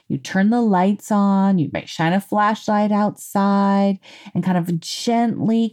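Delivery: ordinary talk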